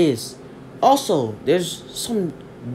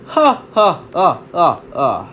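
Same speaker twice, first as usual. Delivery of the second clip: booming hero laugh; 5 dB louder, slower than usual. Acted. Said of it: "and starts laughing".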